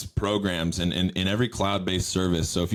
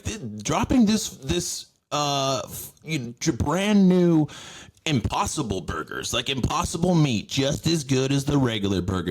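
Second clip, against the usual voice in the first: high hyped commercial pitch; mock ad voice; 6 semitones higher than usual. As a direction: sell fake meat like a cheesy ad. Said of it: "it's just like".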